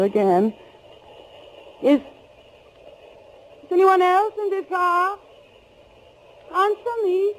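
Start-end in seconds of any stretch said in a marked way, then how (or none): 1.8-7.3 building to a high terrified shout; frightened calling out. Acted. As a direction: demand an answer from the darkness. Calling into the dark car.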